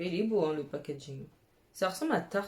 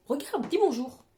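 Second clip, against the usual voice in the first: ordinary talk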